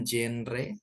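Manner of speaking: genre read as JEN-re with an English j; wrong j reading. Shown as a demonstration. Teacher showing students another misreading, genre as JEN-re. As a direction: say genre as jen-re, clear e's. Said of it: "bukan genre".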